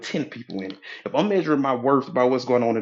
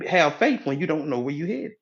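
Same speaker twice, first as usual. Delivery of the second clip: usual speaking voice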